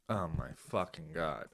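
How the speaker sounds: low, very soft disbelief; hushed, deflated dismay; appalled aside. Real, not acted. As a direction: sigh it out under your voice, appalled.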